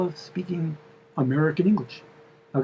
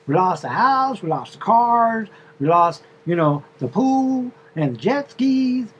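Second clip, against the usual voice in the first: higher, louder southern drawl as the loss sinks in; southern drawl, rising to a loud lament; 6 semitones higher, 3 dB louder than usual. Retold or imitated. The wife lamenting to the driver in a southern drawl. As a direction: build from bragging to a wail of loss in a drawl. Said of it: "a southern drawl".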